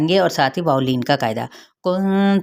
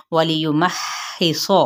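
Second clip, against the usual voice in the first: recitation. Shown as a demonstration. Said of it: "teacher recites Quran word".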